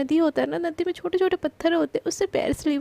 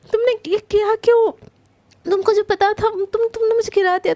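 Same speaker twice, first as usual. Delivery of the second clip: higher, louder outraged protest; indignant outburst; 6 semitones higher, 5 dB louder than usual. Acted. Krishna soaked in the river, yelling at Prachi indignantly. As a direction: accuse her, indignant.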